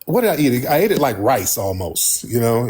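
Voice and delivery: usual speaking voice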